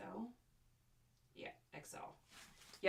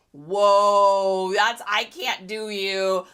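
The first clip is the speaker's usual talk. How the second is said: mock overwhelmed; 9 dB louder, much slower than usual. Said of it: "so I either get".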